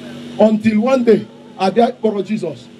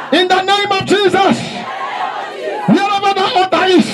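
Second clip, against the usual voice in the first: high, shouted prayer in short phrases; loud prayer declaration; 9 semitones higher, slower than usual. Real, not acted. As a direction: proclaim it for the congregation to repeat. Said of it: "Make sure you are talking".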